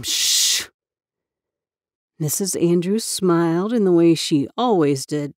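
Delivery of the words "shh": unvoiced hush; shushing. Girl shushing her cousin, unvoiced hush. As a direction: shush him sharply. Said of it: "Summer elbowed him".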